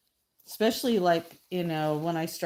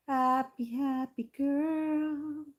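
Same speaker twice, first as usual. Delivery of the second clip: very slow, higher sing-song coo; cooing to painting; 8 semitones higher, much slower than usual. Pretend. Artist cooing at her smiling mermaid painting, sing-song.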